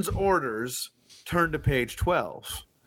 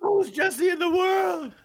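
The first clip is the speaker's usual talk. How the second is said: excited squeal; 14 semitones higher than usual. Real, not acted. Excited shout about Jesse.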